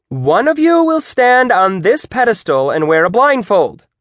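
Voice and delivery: high, loud, over-keen instructions; exclaiming. A narrator's reading. Teacher explaining the trust fall to students, exclaiming loudly. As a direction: announce it with an exclamation mark. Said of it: "Miz Owens exclaimed".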